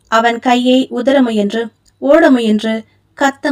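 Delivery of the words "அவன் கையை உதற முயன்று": plain reading voice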